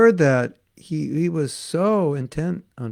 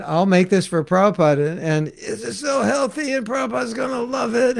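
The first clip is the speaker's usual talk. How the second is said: rising higher, excited to a near shout; the devotee's eager plan, building up; delighted cook imagining praise; eager hopeful thought; 8 semitones higher than usual. Retold or imitated. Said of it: "So he's thinking".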